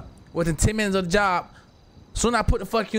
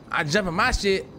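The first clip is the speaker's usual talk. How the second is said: ordinary delivery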